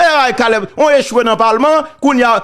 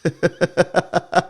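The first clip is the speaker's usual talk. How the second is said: short amused laugh; 2 semitones lower, 6 dB quieter than usual. Real, not acted. Laughs at the candidate's failure.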